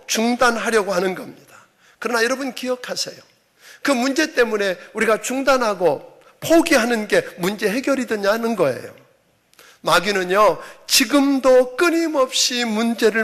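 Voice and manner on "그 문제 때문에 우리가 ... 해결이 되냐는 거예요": usual speaking voice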